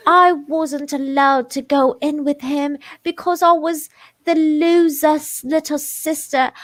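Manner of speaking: high, slow British reading voice; put-on British accent. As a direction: read it in an exaggerated British accent. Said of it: "gonna hate my British accent".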